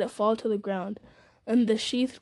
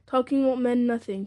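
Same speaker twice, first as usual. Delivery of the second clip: usual speaking voice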